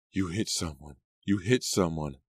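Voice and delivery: ordinary delivery, nothing marked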